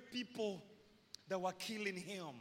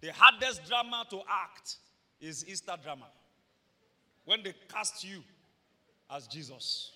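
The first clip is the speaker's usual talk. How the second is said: normal talking voice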